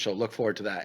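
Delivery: normal talking voice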